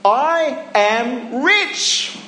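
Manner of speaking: slow, drawn-out I am rich; proud slow declaration. Shown as a demonstration. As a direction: savour each word slowly. Modelling what to tell yourself.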